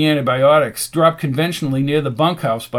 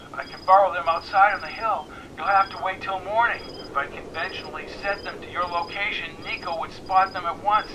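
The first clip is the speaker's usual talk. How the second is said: voice over a device; 3 semitones higher, 5 dB quieter than usual. A narrator's reading. Annie takes McKenna's call, thin phone voice. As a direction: answer as Annie on a filtered line.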